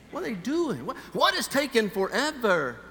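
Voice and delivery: high-pitched impatient inner outburst; fed up with waiting in line; exasperated. Retold or imitated. Acts out his impatient thoughts in line.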